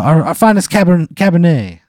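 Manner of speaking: put-on high, loud Italian accent; bad Italian accent. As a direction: proudly present the wine, hammy Italian. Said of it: "a really bad Italian accent".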